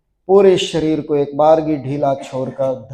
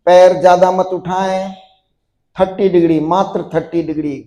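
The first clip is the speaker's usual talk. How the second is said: insistent yoga cueing; 3 semitones higher, 3 dB louder than usual. Acted. Yoga teacher urging students to hold the boat pose, insistent drill cueing. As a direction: keep them tensed and lifted with steady urging. Leads next round of pose.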